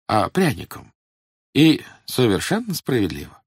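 high, oily police informer; fawning, sly old agent; sly obsequious agent. A narrator's reading. Voices Mylnikov.

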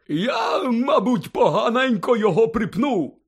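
high, loud, boozy admission; happy drunken character voice, pitched high; tipsy Hagrid, cheerful. A narrator's reading. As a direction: shrug it off like a merry drunk. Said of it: "знизав плечима Гегрід".

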